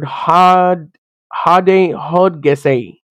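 hodgese sounded out slowly, syllable by syllable; halting Greek attempt. Shown as a demonstration. Preacher stumbles over the Greek word, slow and halting. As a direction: feel your way slowly through hodgese. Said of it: "I'm butchering it".

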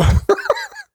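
a squeaky giggle, high up; high squealing laugh. Real, not acted. Co-host squeals with laughter after the joke.

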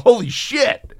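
high, amazed holy shit; excited exclamation. Real, not acted. Podcaster reacting to the show, high amazed exclaim. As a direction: let it burst out in delight.

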